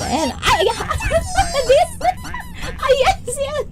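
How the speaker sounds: very high laughing shout; shrieking laughter. Real, not acted. Streamer cracking up as viewers tease her, shrieking laugh. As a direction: break up laughing mid-sentence.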